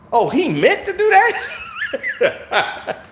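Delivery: slow, very high, laughing astonishment; drawn-out amazed question through a laugh; laughing disbelief. Retold or imitated. After a surprise swish, slow high laughing disbelief. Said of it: "You be like".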